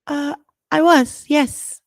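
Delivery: halting, hesitant reply; stuttering nervously. A narrator's reading. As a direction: stumble over the words nervously. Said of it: "Hermione stuttered".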